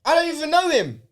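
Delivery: lightly voiced lad brushing him off; mimicking the other guy. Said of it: "He's saying".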